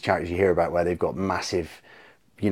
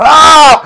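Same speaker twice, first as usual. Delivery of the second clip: very high, very loud mock scream; horrified scream; 17 dB louder than usual. Teller as Shabani learning it is pork, horrified scream. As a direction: imitate a sudden shriek of horror.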